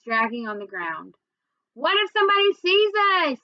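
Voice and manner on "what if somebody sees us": worried holler, pitched high; high, slightly louder narrated shout. A narrator's reading. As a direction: call it out urgently across the street. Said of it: "I hollered out the window".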